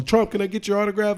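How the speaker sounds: high, loud mock fan voice; eager fan. Fan begging Trump for an autograph, high mock plea. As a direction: play a starstruck fan.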